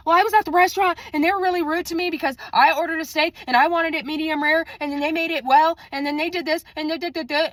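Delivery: fast, high-pitched rambling grievance; mimicked complaining coworker. Coworker moaning about a restaurant steak, fast high mimicry. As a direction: rattle off a petty complaint way up high.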